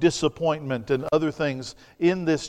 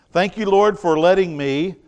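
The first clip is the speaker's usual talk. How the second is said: higher and louder, thankful mock prayer; grateful prayer; 4 semitones higher, 7 dB louder than usual. Preacher voicing a thankful servant's prayer, higher and louder. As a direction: lift it up as glad thanks to the Lord. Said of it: "instead of".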